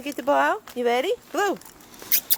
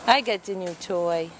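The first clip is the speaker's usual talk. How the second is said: much lower, proud dog voice; voicing the dog; 10 semitones lower, 4 dB quieter than usual. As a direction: speak for the dog, deep and pleased.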